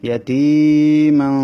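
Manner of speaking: ইয়াতিমান chanted slowly and loud, nasal ending held; slow tajweed chant; Quran recitation. Shown as a demonstration. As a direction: stretch the tanwin of ইয়াতিমান in the nose. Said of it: "এই তানবিনটাকে গুণনা করতে হবে".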